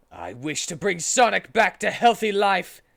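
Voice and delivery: loud, grand summoning call; dramatic invocation. A narrator's reading. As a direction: call on the gods with full force. Shadow makes his wish.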